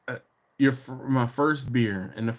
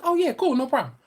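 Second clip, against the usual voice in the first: much higher, louder imitated voice; breezy agreement; 13 semitones higher, 4 dB louder than usual. Girl agreeing to do a favor, breezy imitation. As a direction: play her happy yes, pitched way up.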